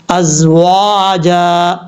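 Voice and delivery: ازواجا recited very slowly with the final ا long-drawn like an alif; قرآنی تلاوت کی طرح ازواجا کو کھینچ کر پڑھیں; Quranic recitation demo. Shown as a demonstration. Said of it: "اس طریقے سے". (ازواجا کو بہت آہستہ، آخر میں ایک الف لمبا کر کے پڑھیں)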